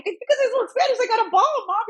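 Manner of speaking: put-on very high child voice, laugh in it; excited kid. Mom imitating son who got the ball, excited. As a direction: imitate a thrilled boy telling Mom. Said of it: "like, so excited".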